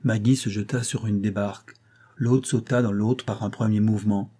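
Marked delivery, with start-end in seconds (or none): none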